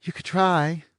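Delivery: high, languid teasing woman; bored blonde. A narrator's reading. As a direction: toss it off, lazily mocking.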